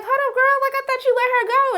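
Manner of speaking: very high, playful challenge; incredulous interjection. Acted. Viewer stopping Serena mid-complaint, high and incredulous. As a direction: cut in with squeaky-high disbelief.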